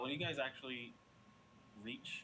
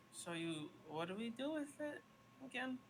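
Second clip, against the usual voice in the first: high fairy voice; 12 semitones higher than usual. Acted. GM voicing fairy asking.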